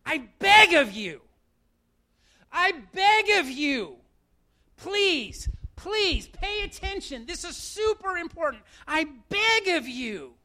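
earnest apostle begging his church; pleading Paul. Acted. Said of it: "what he says is".

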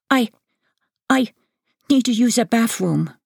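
high, halting stammer. A narrator's reading. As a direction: stammer it out like a caught kid. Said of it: "he stammers".

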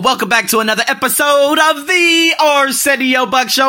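hyped podcast intro. Acted. Podcast host's opening.

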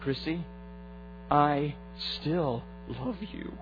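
God whispering lovingly to his daughter; tender whisper. A narrator's reading. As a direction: breathe it gently into her ear. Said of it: "He whispered into my ear".

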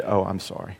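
meek mock apology. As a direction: pretend to back down meekly. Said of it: "you know what Bartimaeus said?".

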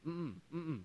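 grumbling religious leaders; disapproving. Retold or imitated. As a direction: refuse it under your breath. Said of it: "looking at them going".

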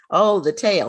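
ordinary talk

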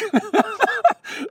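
a quick high real laugh; high burst of laughter. Real, not acted. Actor laughing at his understated line, a high real laugh. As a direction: laugh out at your own understatement.

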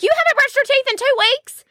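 high-pitched mock disbelief; retold shock. Mom finds out son skipped brushing, high mock-shocked outburst. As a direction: squeal it in appalled disbelief. Retells shock at son's answer.

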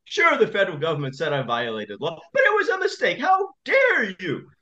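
high mock outburst, breaking into a yell; imitating a furious judge. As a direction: rant like the judge losing his temper. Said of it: "he was shouting at him".

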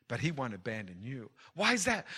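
ordinary talk

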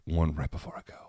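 hushed confiding aside; whisper. Real, not acted. Host slips listeners one last secret, whispering. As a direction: lean in and whisper it.

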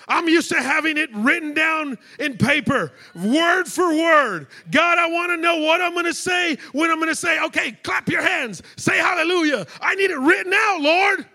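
loud, high prayer cry to the Lord; full-force preaching. Acted. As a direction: pour it out loudly like a preacher.

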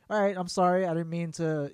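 usual speaking voice

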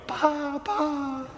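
high, light mimicked pa pa; old ladies' pa. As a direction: repeat the joke fondly. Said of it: "The two old ladies kept on going".

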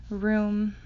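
usual speaking voice

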